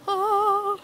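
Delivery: high, loud, held "ahh"; blissful "ah". Acted. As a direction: sing out an exaggerated sigh of delight. Imitates relief at warm May weather.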